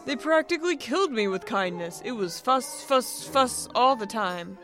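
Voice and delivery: exasperated complaint. Acted. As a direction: complain bitterly about being fussed over. Gnarly bitterly protests the fuss.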